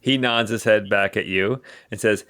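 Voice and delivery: ordinary talk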